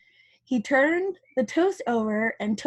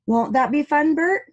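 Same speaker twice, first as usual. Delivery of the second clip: rising, bright question; eager Ernie; 5 semitones higher, 4 dB louder than usual. Acted. Ernie pitching his idea to Bert, lightly eager. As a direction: coax Bert into the fun. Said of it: "said Ernie".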